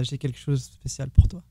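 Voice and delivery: smooth French phrase for the ladies. Shown as a demonstration. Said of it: "why don't you say in French".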